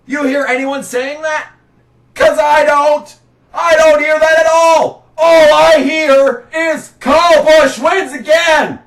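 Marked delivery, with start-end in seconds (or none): 2.1-8.8 raised, indignant ranting voice; louder, pushing the complaint; exasperated shouting rant. Real, not acted. Ranting about media attention.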